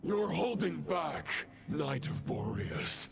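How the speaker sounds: menacing villain. Acted. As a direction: taunt him like a dark knight. Villain speech in a boss fight.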